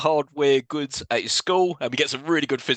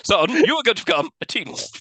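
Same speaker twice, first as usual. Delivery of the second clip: a higher, hammed-up fatherly pronouncement; mock solemn dad; 10 semitones higher, 3 dB louder than usual. Dad telling Scott his fate, hammed-up solemn. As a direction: play the grave dad breaking big news, tongue in cheek. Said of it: "probably to tell him".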